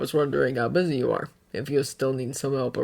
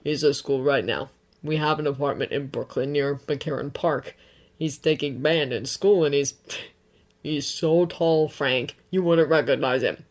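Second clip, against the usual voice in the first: reassuring; 5 semitones lower, 4 dB quieter than usual. A narrator's reading. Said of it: "Bucky assures".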